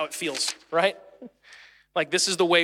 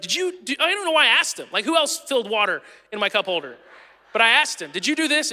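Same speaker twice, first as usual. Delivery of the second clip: angry dad; 5 semitones higher, 6 dB louder than usual. Retold or imitated. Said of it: "You're like".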